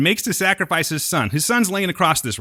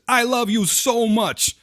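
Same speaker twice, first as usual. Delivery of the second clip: Abraham's love cry; 8 semitones higher, slower than usual. Retold or imitated. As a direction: overblown tearful devotion, hammed up. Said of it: "back to where Abraham says".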